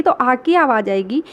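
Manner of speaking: usual speaking voice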